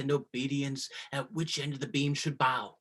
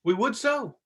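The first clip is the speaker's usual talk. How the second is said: loud mocking jest; 5 semitones higher, 7 dB louder than usual. Courtiers mocking Gonzalo.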